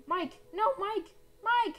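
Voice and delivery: high, loud cry to a friend; desperate shout. Pretend. Player crying out to teammate Mike, desperate shout. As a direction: yell out in desperation.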